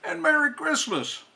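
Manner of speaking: jolly Santa. Acted. Santa greeting boys and girls, booming and jolly. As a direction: greet the kids as a hearty Santa.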